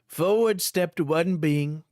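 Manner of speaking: normal talking voice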